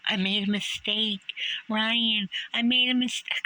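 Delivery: very high, straining pleading impression; mock high voice. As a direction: squeak it out in a mocking falsetto plea. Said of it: "I can't make my voice go that high".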